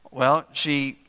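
usual speaking voice

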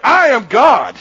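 loud, forceful claim; emphatic declaration. Retold or imitated. Jesus makes his point to the scribes, declaring boldly. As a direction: proclaim it with full weight.

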